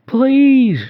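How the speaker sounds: put-on very high, loud childlike plea; begging ghost girl. A narrator's reading. Ghost girl begging the narrator, very high and loud. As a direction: beg with everything you have. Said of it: "she begged".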